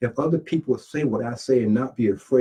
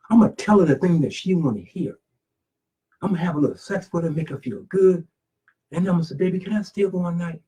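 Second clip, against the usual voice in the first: higher-pitched mock sweet-talker; scheming freeloader; 6 semitones higher, faster than usual. Homeless man planning to charm a working woman, lightly acted scheming. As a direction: lightly act a man plotting his way in.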